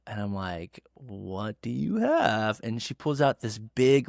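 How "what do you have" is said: slow, stretched-out suspicious asking; drawn-out wary question. Retold or imitated. Son-in-law answering mother-in-law's teasing, drawn-out wary question. Own wary reply, acted out.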